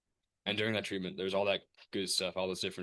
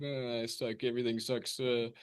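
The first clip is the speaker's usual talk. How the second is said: higher-pitched mock whine, self-imitation; whiny moaning; 5 semitones higher than usual. Retelling endless calls complaining to mom, whiny moaning. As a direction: moan and gripe in an exaggerated whine. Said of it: "complaining and bitching and moaning".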